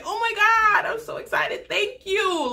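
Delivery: high, gushing fake delight; mock excitement. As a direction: squeal it like a thrilled girlfriend. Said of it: "accept that and say".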